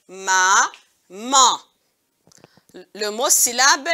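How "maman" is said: "Maman" split into a long ma, then man; syllable by syllable. Shown as a demonstration. Teacher cutting a word for pupils, "Maman" in two slow syllables. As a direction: draw out and separate both syllables of "Maman". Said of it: "on a coupé le mot maman".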